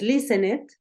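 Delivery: "listened" said as three syllables, "li-sen-et"; wrong extra syllable. Shown as a demonstration. Teacher shows what you don't say, extra syllable. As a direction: add a full "et" to the end of "listened". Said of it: "No dices".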